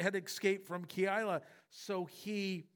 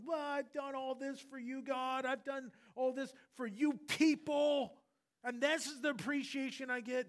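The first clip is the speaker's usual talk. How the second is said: pitched up, aggrieved whine of someone unthanked; mock resentful complaint; 7 semitones higher than usual. David imagined griping to God, mock aggrieved. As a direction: play the sulking servant listing his favours.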